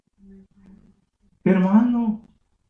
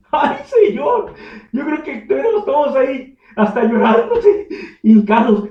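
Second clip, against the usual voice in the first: higher, laughing exclamation; 7 semitones higher, 10 dB louder than usual. Real, not acted. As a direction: laugh through the picture of it. Laughing at imagined scene.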